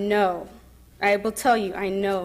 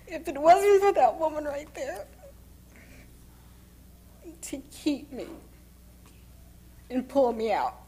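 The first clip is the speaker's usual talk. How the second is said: soft, tear-filled voice breaking; choked-up crying; 3 semitones higher, 9 dB quieter than usual. Real, not acted. Telling how her mother saved her, quietly crying. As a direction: fight through real tears.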